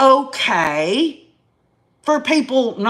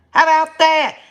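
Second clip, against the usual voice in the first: punchy and raised, daring them to answer; defiant challenge; 6 semitones higher, 4 dB louder than usual. Real, not acted. To the critics on her channel, a loud defiant dare. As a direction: throw it back at them, louder.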